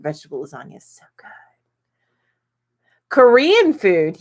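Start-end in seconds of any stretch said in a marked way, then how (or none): 0.0-1.3 breathy whispered aside. Real, not acted. Reading viewer food picks, she whispers a craving. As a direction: whisper it to yourself, savoring.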